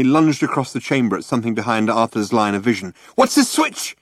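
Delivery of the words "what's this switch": sudden cry. A narrator's reading. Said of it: "he cried".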